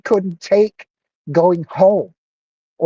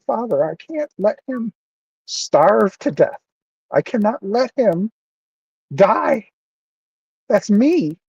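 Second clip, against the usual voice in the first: choked up, voice pitched high; real emotion pushing the voice higher; getting emotional; 5 semitones higher than usual. Real, not acted. Pleading to Father for a starving man, choked up. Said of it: "I'm getting emotional".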